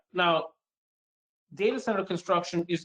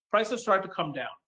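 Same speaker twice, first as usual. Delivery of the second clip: ordinary delivery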